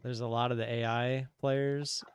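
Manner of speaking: ordinary talk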